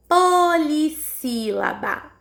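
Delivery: "Polissílaba" slowly, every syllable held apart; sílabas destacadas. Shown as a demonstration. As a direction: enunciate "Polissílaba" in drawn-out beats. Teacher enunciates new term slowly. (polissílaba pausada, sílaba por sílaba)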